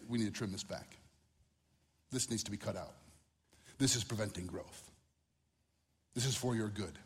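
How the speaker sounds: soft, low, caring voice of someone tending your soul; gentle imagined counsel. Pretend. A wise guide walks the preacher round his soul, softly. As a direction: lay it out kindly, like a patient gardener.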